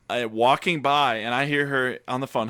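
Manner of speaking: ordinary talk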